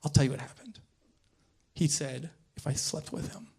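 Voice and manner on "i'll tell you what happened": hushed, breathy gossip; gossipy whisper. Retold or imitated. Actress friend confiding about the casting director, whispered. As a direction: lean in and whisper the secret.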